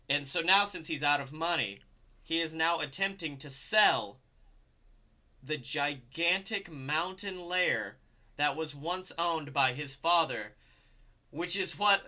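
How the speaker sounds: usual speaking voice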